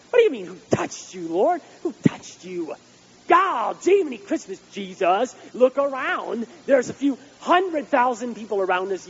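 loud, high, exasperated scoffing; know-it-all disciple. Retold or imitated. Disciples scoffing at Jesus in the crowd, loud and exasperated. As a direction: play the disciple rolling his eyes at Jesus. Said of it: "the Mister Know-It-All".

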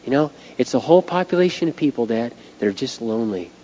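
soft, choked-up imitation of his daughter; a mild hint of a tearful daughter; retold teary plea. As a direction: quietly relay her near-tearful words. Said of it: "and she starts crying".

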